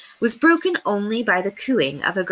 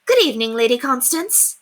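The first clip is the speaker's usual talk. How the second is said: bright, raised, calling out cheerfully; cheerful greeting; 6 semitones higher, 4 dB louder than usual. Acted. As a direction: call a cheery hello. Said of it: "she called out cheerfully".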